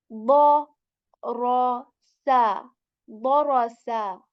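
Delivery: "Bo, ro, sa" with a loud full-mouth "Bo"; heavy bo syllables. Shown as a demonstration. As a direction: hit "Bo" heavy, then "ro, sa". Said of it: "The starting sound is like bo".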